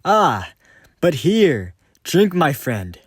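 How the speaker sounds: high, loud fake friendliness; false cheer. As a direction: offer the drink too brightly. False cheer offering wine.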